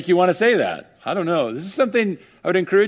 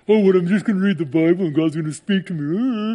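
mocking skeptic; 2 semitones higher than usual. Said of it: "they just make fun of it".

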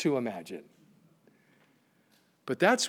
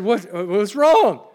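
louder, higher, stumbling question; retold startled question; 5 semitones higher, 8 dB louder than usual. Husband asking wife at midnight, acted alarm. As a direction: imitate an alarmed husband blurting it out.

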